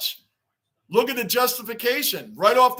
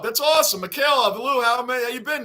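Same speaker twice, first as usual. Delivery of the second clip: ordinary delivery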